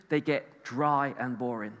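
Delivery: low, soft, slow deadpan; dry and boring. Real, not acted. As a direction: let the energy drain out, slowing down. Sound mimics dry word churches.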